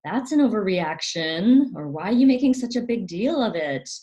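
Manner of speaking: mock a parent waving it all away; dismissive parent. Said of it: "a parent will say".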